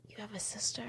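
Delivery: hushed, breath-only question; mock secret whisper. Housekeeper nearly telling Hallie, mock secret whisper.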